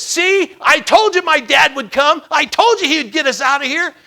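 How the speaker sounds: put-on high, triumphant boyish boast; excited son. Son tells classmates his dad came, excited triumphant voice. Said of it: "he heard his son say this".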